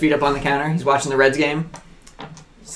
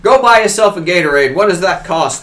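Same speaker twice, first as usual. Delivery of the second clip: louder, higher clueless rich father; out-of-touch dad; 6 semitones higher, 5 dB louder than usual. Retold or imitated. Dad handing his son cash, clueless and loud. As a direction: toss out cash like money means nothing. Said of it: "He's like".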